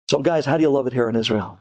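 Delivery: put-on quick, low Brooklyn accent; casual Brooklyn host, quick and low. Host asks visiting guys about Israel, Brooklyn accent. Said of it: "with the Brooklyn accent".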